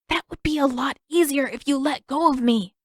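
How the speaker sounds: hiss. A narrator's reading. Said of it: "Aang hissed back".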